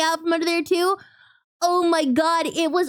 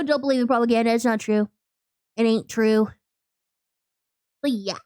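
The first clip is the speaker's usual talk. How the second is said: usual speaking voice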